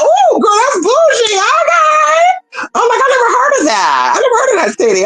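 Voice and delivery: high-pitched squealing mock astonishment; amazed reaction. Reacting to an unknown bougie-sounding city, hammed-up amazement. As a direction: squeal it like you're impressed by something fancy.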